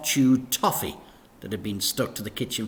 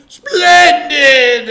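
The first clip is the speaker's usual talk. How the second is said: high, loud delighted cry; exclaimed; 19 dB louder than usual. A narrator's reading. Vicar delighted at the idea, loud exclamation. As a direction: burst out in delight. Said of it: "exclaimed the vicar".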